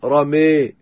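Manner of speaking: رمي ending in a long tilted "ramay"; long e-like vowel after the م in رمي; imala. Shown as a demonstration. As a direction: tilt the final a of رمي toward e. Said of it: "أمال فتحة الميم والألف".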